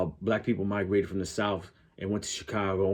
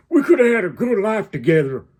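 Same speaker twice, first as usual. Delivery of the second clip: mock Southern accent; 9 semitones higher, 7 dB louder than usual. Making fun of Brokeback Mountain, exaggerated Southern accent. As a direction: belt it out as an overblown Arkansas accent. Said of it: "who from Idaho is talking like that".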